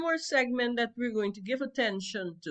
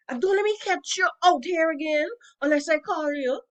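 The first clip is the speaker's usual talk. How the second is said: scolding; 6 semitones higher, 6 dB louder than usual. Acted. Mother scolding daughter.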